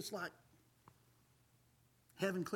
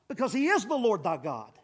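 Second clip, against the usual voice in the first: usual speaking voice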